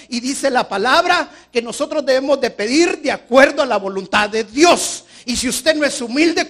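preacher at full force; fiery preaching. Acted. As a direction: thunder it at the congregation.

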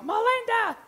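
put-on child finally yelling for mom, loud and high; kid's yell. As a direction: bellow Melinda like a child who's been ignored. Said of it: "finally they yell".

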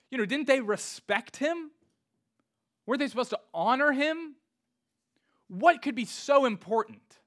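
high, loud incredulous questions; shocked indignation. Acted. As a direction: demand answers like a scandalized onlooker. Voices shock at sons leaving father.

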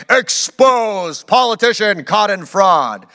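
loud, slow headline proclamation; newspaper exposé headline. Pretend. Preacher voicing an exposé headline, booming mock headline. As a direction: blare it out like a scandal headline. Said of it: "image you get is of a newspaper running".

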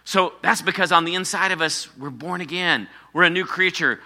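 ordinary delivery

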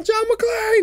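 high-pitched, drawn-out movie-hero yell; mock yell. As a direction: holler the name way up high, held out. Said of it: "And I yell".

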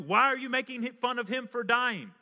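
one dying criminal rebuking the other, loud and sharp. Acted. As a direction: scold him from the next cross. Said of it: "the other says".

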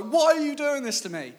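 high, loud exasperated outburst; shrill-pitched mock meltdown; worked-up wail. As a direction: re-enact an overwrought teenage protest. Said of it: "eventually I'm so worked up".

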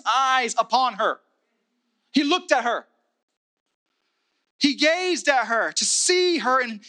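impassioned high preaching voice. Acted. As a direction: preach it with rising fervor. Preaching at full force, raised pitch.

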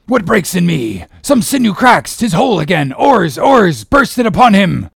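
high, loud captain's battle cry; urgent outcry. A narrator's reading. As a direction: shout wildly to drive the rowers onward. Captain's cry as line snaps.

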